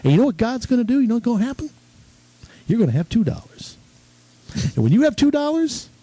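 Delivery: a hint of a preacher selling faith; half-hearted televangelist sermon; Robert Tilton impression. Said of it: "He would say things like".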